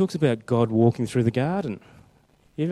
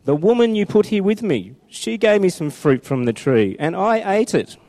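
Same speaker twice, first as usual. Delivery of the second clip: whiny excuse; 8 semitones higher, 6 dB louder than usual. Acted. Reading Adam blaming Eve.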